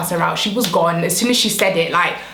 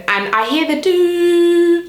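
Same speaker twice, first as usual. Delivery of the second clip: dial tone imitation; 9 semitones higher, 3 dB louder, much slower than usual.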